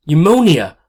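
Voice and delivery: high, loud shocked cry of fear; frightened cry. Acted. Mother hearing her baby may have pneumonia, shocked loud cry. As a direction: gasp the word out in alarm.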